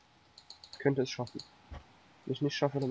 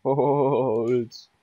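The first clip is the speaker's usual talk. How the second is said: mock voice; 7 dB louder than usual. Mocking echo of friend.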